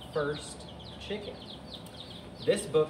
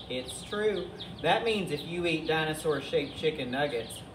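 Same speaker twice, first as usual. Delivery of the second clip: ordinary delivery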